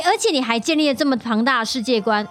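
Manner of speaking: normal talking voice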